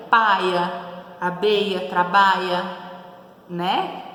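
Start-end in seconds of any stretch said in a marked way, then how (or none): 0.1-2.6 Paia and abeia with I instead of LH, loud; sertanejo accent. Retold or imitated. Teacher voicing the sertanejo of Patativa, loud. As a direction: swap lh for i like a sertanejo. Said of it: "como eu falaria".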